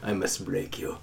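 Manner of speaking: deep, quiet Drago growl; low menacing Russian threat; Russian accent. Shown as a demonstration. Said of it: "Just try this, try this once".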